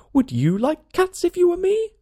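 high, loud indignant squeal; outraged mouse squeaking back; shrill passionate mouse. A narrator's reading. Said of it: "in a shrill, passionate voice".

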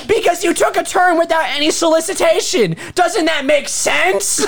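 high-pitched, exasperated comic tirade; indignant rant. Retold or imitated. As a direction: plead your case in shrieking outrage. Continues acted yelling at Austin.

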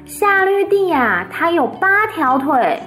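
put-on high, bright-eyed little girl voice; little Fern chattering to her parents, high and eager. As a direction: tell it like an eager child reporting news. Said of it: "她就说".

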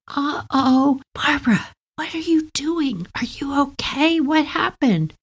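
put-on high, hushed worried older sister; big sister voice. Older sister whispers worried questions to Barbara at night. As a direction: check on little sister in the dark.

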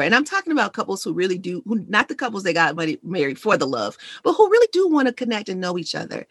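ordinary talk, nothing marked

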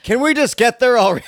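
fast, high, loud complaint; impatient yell. Retold or imitated. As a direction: yell it at the train. Impatient passenger yelling.

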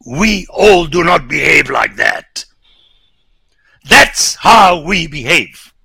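a rising rebuke that peaks and slows; preaching shout. Real, not acted. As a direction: drive the accusation home. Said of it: "don't tell me that".

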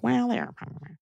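high, soft, prim tone; prissy mock voice. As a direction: mimic a prissy scold. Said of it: "You're like".

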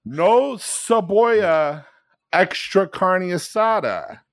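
drawn-out, high Spanish order; halting dad voice. Retold or imitated. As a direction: imitate Dad stretching every Spanish word. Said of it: "in this halting voice".

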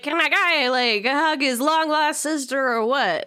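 lightly hammed-up brash guy at the door; Jamie Taco bro. Mocking Pietro's doorway line, lightly hammed-up bro voice. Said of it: "it's very Jamie Taco from I Think You Should Leave".

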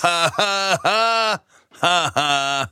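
high staccato ha-ha cackle in a put-on voice; imitating Jack Nicholson's famous laugh. Doing Jack Nicholson laughing for Jen. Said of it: "That's how he laughs".

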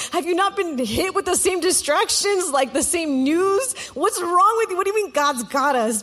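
like you can't believe he's this calm; exasperated disbelief. Retold or imitated. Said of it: "And I'm looking at him like".

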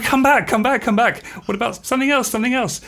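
high, loud mock-desperate begging; frantic retold pleading. Band begging [name] for another chance, mock-frantic. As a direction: call someone back in comic panic.

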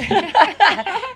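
high squealing laugh; helpless high-pitched laughter; burst of laughter. Real, not acted. Reading a funny comment.

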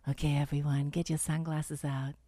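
low sexy voice brought down a notch; failed seductive. Shown as a demonstration. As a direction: drop the voice low and sultry. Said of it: "I brought it down a notch".

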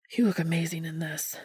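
Dean whispering his admiration; admiring whisper. A narrator's reading. Dean admiring Cass intimately.

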